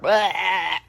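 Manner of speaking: imitated cartoon choking noise; strangled choking cry. Bart being choked by Homer, imitated strangled cry. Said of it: "Homer chokes Bart".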